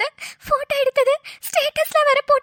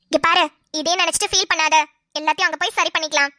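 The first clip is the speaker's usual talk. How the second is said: lower, urgent friend pressing her advice; a lower-voiced scheming friend urging the crying wife; scheming friend Roja; 8 semitones lower than usual. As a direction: push her to go home now, low and insistent.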